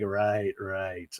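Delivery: low, deadpan "right, right"; low resigned mumble; mock weary Terry.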